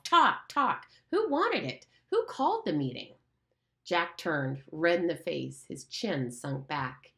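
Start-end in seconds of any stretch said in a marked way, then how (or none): 0.0-3.1 loud, high, furious; angry shout. Acted. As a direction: hurl the questions at Jack. Ralph continues shouting at Jack.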